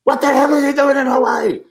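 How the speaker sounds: high, squeaky mock outraged widow; put-on voice of an outraged widow. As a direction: pitch way up as the furious widow.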